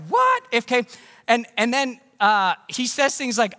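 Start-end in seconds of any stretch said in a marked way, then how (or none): 0.1-0.4 much higher, louder stunned What?; shocked what. Retold or imitated. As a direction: gasp out the crowd's disbelief. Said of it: "People are like".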